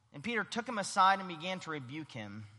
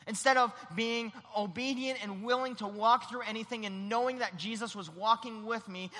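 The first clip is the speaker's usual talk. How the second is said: ordinary talk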